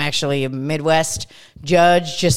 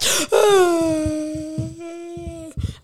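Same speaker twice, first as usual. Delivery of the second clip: sharp gasp; 11 semitones higher than usual. Retold or imitated. Retold waking up sweaty.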